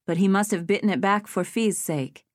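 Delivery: ordinary delivery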